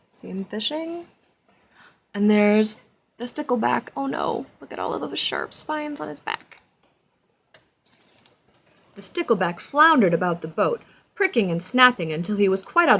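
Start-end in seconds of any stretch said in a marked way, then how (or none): 0.1-6.6 alarmed. Acted. Pointing out the picture, alarmed.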